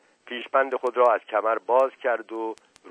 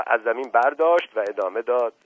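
ordinary delivery

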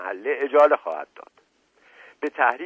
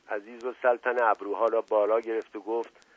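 ordinary delivery, nothing marked

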